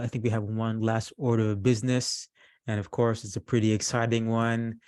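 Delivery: usual speaking voice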